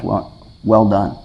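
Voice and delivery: slow, low, drawn-out well well done; savoured praise. Pretend. Pastor savouring God's slow well well done. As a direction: linger on it like treasured praise.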